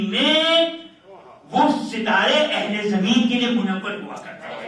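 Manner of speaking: slow, emphatic sermon delivery; building, drawn-out preacher's oratory; preaching at full force. Real, not acted. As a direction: stretch each phrase out for the congregation. Preaches the hadith's meaning to crowd.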